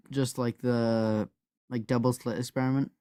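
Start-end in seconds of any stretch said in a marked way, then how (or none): none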